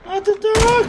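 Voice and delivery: very high squeaky whine; squeaky mock voice. Repeats in put-on voice.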